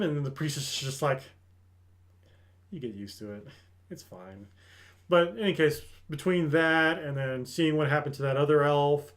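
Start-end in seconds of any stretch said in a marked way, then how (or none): 2.7-4.5 very soft, low imitated acceptance; deadened little reassurance, barely voiced; quiet resignation. Priestess answering the distressed elf, softly resigned.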